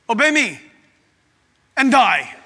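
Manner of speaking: loud, mock menacing command; terrorist God. Preacher mocks the idea of a terrorist God, loud threat. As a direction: order it forcefully, breaking it in two. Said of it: "He's not a terrorist God".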